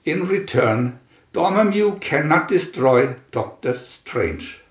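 plain reading voice

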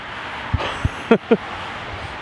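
airy, voiceless chuckle; soft breathy laugh. Real, not acted. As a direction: laugh softly under your breath. Laughs at his boring video.

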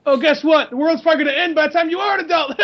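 high, loud, rushed gloating; mock-gleeful taunt. Adult taunting a kid about growing up, mock-gleeful. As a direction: rub it in fast and loud.

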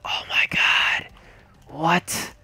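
breathless whispered disbelief; a face reacting in a stunned whisper; whispered shock. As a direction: hush it like a stunned aside. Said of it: "and be like".

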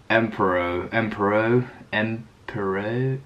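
"Emperor" tried haltingly, low, questioning at the end; "Emperor" as a tentative reading attempt, last one stretched; unsure sounding-out. Real, not acted. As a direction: feel out "Emperor" slowly, unsure of its ending. Struggles to read the brand name.

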